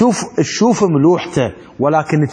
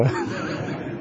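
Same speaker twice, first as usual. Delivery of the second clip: quiet laugh; 12 dB quieter than usual. Real, not acted. Laughs at joke.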